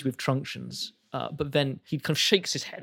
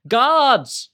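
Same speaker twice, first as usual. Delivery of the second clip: loud, high shout for the guards; calling out; 11 semitones higher, 11 dB louder than usual. Acted. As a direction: bellow over your shoulder. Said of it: "He calls out to the back".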